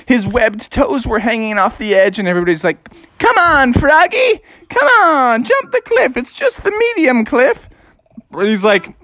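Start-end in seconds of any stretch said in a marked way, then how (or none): 3.2-7.7 high-pitched cheering friends; acted crowd goading, pitched way up; egging him on. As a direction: urge a nervous pal from afar.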